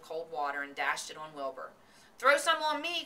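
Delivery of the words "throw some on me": high, loud eager cry; excited cry. A narrator's reading. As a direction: beg to be splashed too. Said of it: "cried Avery".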